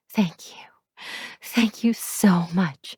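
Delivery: slow, breathy whispered thanks; relieved whisper. Acted. Tenant thanking the landlord, whispered relief. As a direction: whisper it, almost giddy with relief.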